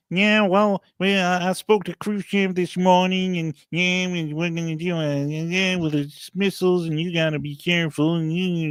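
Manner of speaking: a weird cowboy take on a president; Kennedy impression. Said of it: "That was the best John F. Kennedy you're gonna get out of me".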